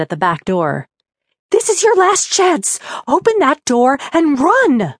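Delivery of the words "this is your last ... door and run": furious ghostly shout. A narrator's reading. Ghost's furious final warning.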